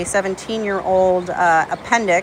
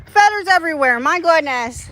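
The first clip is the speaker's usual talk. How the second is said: "Feathers" said much higher, exasperated; 8 semitones higher than usual. Real, not acted. Owner dismayed at feathers in the horse pool, mock exasperation.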